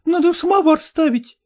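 high, loud servant's reply; Fyokla calling back. A narrator's reading. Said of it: "откликнулась Фёкла".

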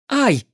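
short high yelp of pain; a sudden high "ow". A narrator's reading. Mage touches her sore cheekbone, pained yelp.

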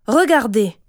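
loud, indignant call to look; angry command. Acted. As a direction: show everyone the culprit. Angry mother exposes child to family. (ordre indigné)

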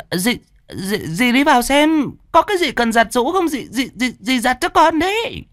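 high-pitched, stuttering excuses with an awkward laugh; flustered stammering. A narrator's reading. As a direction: stumble over words like someone caught snooping. Stepmother stammers excuses when questioned. (giọng dì ghẻ lắp bắp, chống chế)

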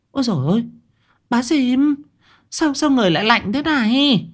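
high-pitched alarmed woman's voice; startled cry. A narrator's reading. Wife recoils from her sister-in-law's icy body, crying out. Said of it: "Tiếng kêu của bà Lụa".

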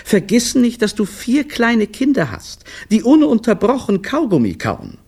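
high reproachful reminder; reproachful wife. A narrator's reading. Wife reminds her husband of the children, high and reproachful. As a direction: remind him of his duty. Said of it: "sagte Olivia vorwurfsvoll".

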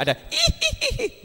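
high, slow mock ghost giggle; a quick spooky giggle. Ghost giggle imitated again. (tawa hantu tiruan)

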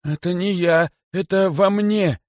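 high, panicked protest; horrified confession. A narrator's reading. Kosoy confesses to the great python, terrified. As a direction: blurt it high-pitched in terror. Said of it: "в ужасе признался Косой".

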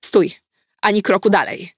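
loud commanding call down the stairs; firm loud halt; calling out to stop. A narrator's reading. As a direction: shout a warning at the intruder. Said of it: "zawołałam".

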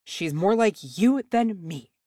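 a hint of a slow, sharp hiss; lightly voiced dwarf hissing at his wife; hissed back. A narrator's reading. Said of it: "Bombur hissed back".

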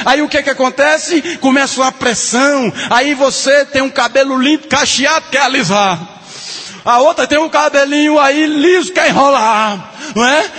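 high, loud, driving sermon voice; full-force preaching. Acted. Preacher to the women of the church, full-force preaching.

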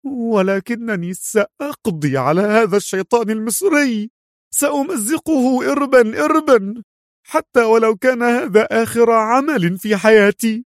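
high-pitched furious vow; heated, high and forceful character voice; violent anger. A narrator's reading. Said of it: "برقت عيناها غضبا وهي تستطرد في عنف".

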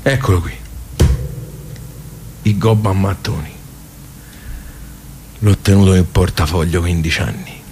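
put-on old man closing the club for the last time, softly; quiet resolve. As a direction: say goodbye to the place gently.

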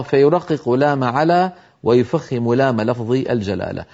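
ordinary talk, nothing marked

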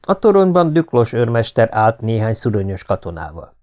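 ordinary delivery, nothing marked

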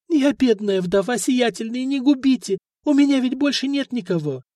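high wailing plea; tearful widow's plea. A narrator's reading. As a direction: sob out the plea. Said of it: "снова зарыдала".

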